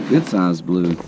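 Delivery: ordinary talk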